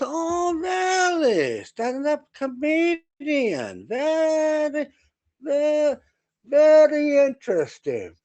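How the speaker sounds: high, slow, mock-impressed German accent; German captain accent. German captain needling the stand-up comedian, high slow German accent. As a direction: stretch out very very interesting, German-style. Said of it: "he was German".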